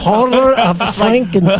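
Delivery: imitated horror-movie announcer reading the title; slow mock announcer. Host mimicking announcer who stopped for English titles, mock announcer voice. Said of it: "it was always slow".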